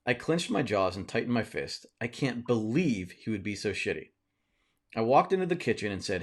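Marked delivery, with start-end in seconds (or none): none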